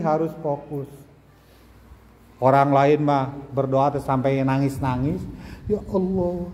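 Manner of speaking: ordinary talk, nothing marked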